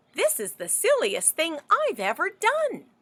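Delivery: high, embarrassed cow; lightly voiced sheepish cow. Cow's thought voiced.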